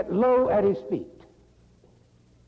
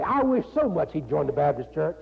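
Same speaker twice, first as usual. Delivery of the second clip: usual speaking voice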